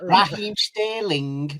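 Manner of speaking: drawn-out "Raheem Sterling" with a heavy -ing ending; mocking commentator's slow "Raheem Sterling"; Steve McManaman. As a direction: drag out "Sterling", leaning on the final "ing". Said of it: "That's how he says it".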